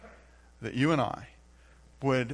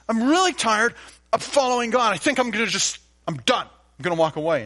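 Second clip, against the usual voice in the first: whiny, higher, louder complaint; mock exasperated voice, much higher and louder; fed-up believer; 8 semitones higher, 7 dB louder than usual. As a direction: gripe like a worn-out worker ready to quit.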